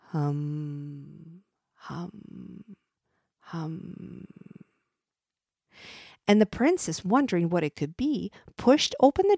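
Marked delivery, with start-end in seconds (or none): 0.1-4.3 quiet mysterious hum; soft humming. A narrator's reading. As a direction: hum it softly, fading away. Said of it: "a curious humming noise".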